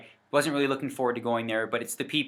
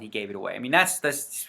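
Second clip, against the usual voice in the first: ordinary talk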